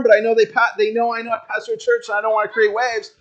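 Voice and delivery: ordinary talk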